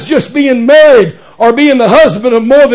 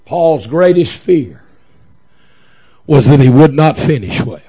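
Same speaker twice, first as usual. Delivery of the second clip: grave and measured, much lower; hushed, solemn preaching; 8 semitones lower than usual. Real, not acted. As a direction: bring it right down for a grave point. Solemn drop after the climax.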